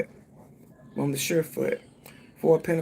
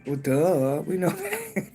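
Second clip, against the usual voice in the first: sassy laugh; slower than usual. Real, not acted. Laughing back at the spirit's obvious answer.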